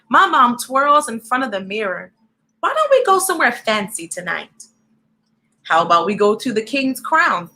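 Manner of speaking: lively storybook read-aloud; reading to children. A narrator's reading. Reading a picture book aloud to young viewers, lively.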